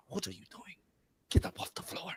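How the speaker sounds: put-on hushed, low Russian-accented father; low Russian-accented near-whisper. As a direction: imitate a stern Russian dad, very softly. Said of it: "That's a good Russian accent".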